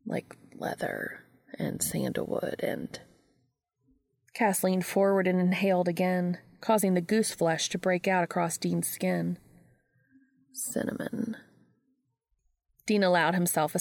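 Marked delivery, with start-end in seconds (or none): 0.0-3.0 slow, raspy whisper; sandpaper voice. A narrator's reading. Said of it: "voice like sandpaper". 10.5-11.4 sandpaper voice. A narrator's reading. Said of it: "voice like sandpaper".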